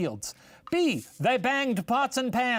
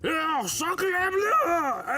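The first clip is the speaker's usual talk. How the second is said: slow, shouted, high French exclamation; French accent; 9 semitones higher, much slower than usual. Acted. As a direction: draw out sacre bleu in a hammy French accent. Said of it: "a thing the French say".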